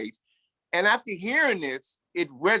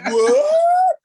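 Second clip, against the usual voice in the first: high, loud, laughing mock shriek of shock; shocked cry; 10 dB louder than usual. Young interviewer hears forty-three years inside, laughing high shocked "What?". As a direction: squeal it like a stunned young interviewer.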